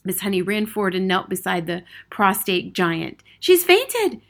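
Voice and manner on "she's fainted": high, alarmed cry; startled teacher's cry. A narrator's reading. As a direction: call it out urgently, pitched high. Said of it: "she cried".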